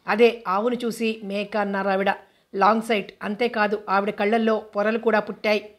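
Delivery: ordinary delivery, nothing marked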